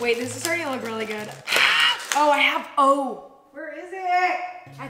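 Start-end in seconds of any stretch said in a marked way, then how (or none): 1.3-4.4 loud gasp then hurried search; sudden gasp. Real, not acted. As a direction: gasp as if remembering. Remembers something, searching.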